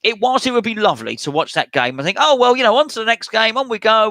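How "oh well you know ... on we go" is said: higher and louder, cheerfully shrugging it off; mock breezy optimism. Fan imagining shrugging off a heavy defeat, breezy. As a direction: pretend not to care about the loss.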